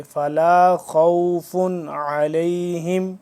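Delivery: loud slow chant, خَوْفٌ with a thick, full kh; tajweed recitation with a heavy throaty kh in خَوْفٌ; heavy kha. Shown as a demonstration. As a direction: fill the mouth on the kh of خَوْفٌ. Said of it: "خا کو موٹا پڑھیں گے پور کر کے".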